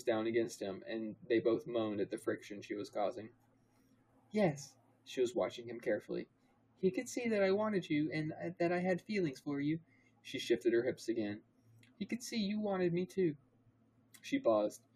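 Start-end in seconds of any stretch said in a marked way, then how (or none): 4.3-4.7 put-on higher-pitched girl's reply; lightly voiced higher female character; Hermione. A narrator's reading. Narrator voicing Hermione's answer, higher character voice. 6.8-9.8 higher female character voice; Hermione. A narrator's reading. Narrator as Hermione confessing feelings to Harry, higher voice. 12.0-13.3 higher female character voice; Hermione. A narrator's reading. Narrator as Hermione pressing Harry, higher voice.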